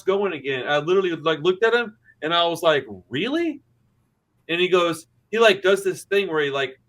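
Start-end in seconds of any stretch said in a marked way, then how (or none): 3.1-3.6 high, rising incredulous "really?"; in pointed disbelief, pitch shooting up. Retold or imitated. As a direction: challenge him with a sharply rising question. Confronting a noisy neighbour, retold.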